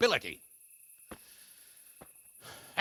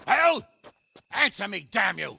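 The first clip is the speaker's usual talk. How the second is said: loud, higher bellow of rage; furious shout; 7 semitones higher, 5 dB louder than usual. Acted. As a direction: storm in and roar at the brawlers. Lord Hanush breaks up a fight.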